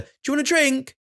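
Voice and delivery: put-on very high sing-song question to a toddler; high-pitched toddler talk. Shown as a demonstration. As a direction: sing it up high to a little one. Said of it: "communicating via singing or the high-pitched register".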